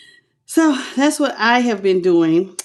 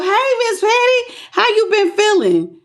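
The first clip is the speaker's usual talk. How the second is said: excited greeting; 10 semitones higher than usual. Real, not acted. Greets a returning viewer excitedly.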